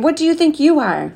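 high-pitched indignant challenge; screamed. A narrator's reading. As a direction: throw it out high, without full volume. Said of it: "she screamed".

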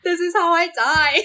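mock-tragic laughing cry; laughing mock doom. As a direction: wail it through laughter. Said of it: "joking about creepy encounter".

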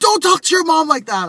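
high, loud mock dad voice; dad scolding his kid; stern dad. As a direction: scold like an imitated father. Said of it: "my dad's like".

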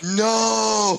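loud high shout of disbelief; shocked shout. Real, not acted. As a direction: cry out in horror. Reacting to a bag of piss.

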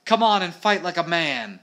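high, loud fighting challenge; shouting. A narrator's reading. Alf daring the monster to fight him, shouting. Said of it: "Alf was shouting".

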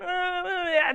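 high, loud wavering groans; possessed groaning. Retold or imitated. Possessed guy reeling at the shouted command, groaning. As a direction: imitate a demon reeling in groans. Imitates possessed guy's reaction.

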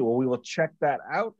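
usual speaking voice

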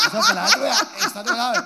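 high, loud, laughing protest; excited kid protest. Retold or imitated. Kid insists nurse is new.

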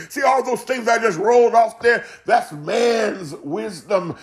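ordinary talk

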